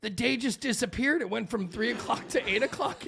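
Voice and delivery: put-on high-pitched, baffled kid protest; mock bewilderment. Kids told it's bedtime at five, mock bewildered.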